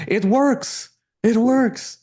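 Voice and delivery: high, loud elated cry of success; excited. Retold or imitated. Client thrilled about the deal, excited high cry. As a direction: shout it like the big win just landed. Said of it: "And he says".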